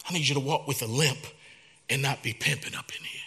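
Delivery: fast whisper, mostly breath; a secret whispered down from heaven; hushed voice of God. Acted. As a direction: lean in and whisper God's words. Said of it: "God said".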